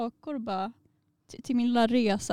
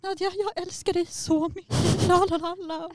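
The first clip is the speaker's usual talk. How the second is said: imitating drunk friend; 7 semitones higher than usual. Drunk friend declaring love, high gushing imitation. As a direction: gush affection like a tipsy friend. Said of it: "när hon blir full ibland då bara".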